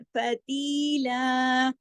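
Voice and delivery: फतीला very slow, ती held two counts; tajweed recitation. Shown as a demonstration. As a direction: stretch ती in फतीला. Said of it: "फ एक हरकत, ती दो हरकत". (फतीला में ती को दो हरकत)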